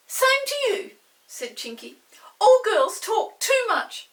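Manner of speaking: high, loud, slow pixie jibe; pixie retort. Acted. Chinky answers Molly, high and cheeky. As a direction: fire it back like a cheeky pixie.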